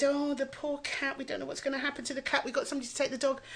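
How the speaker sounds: high, soft, hurried fretting; retold fretting friend. Imitating friend worried about the orphaned cat, high soft fretting.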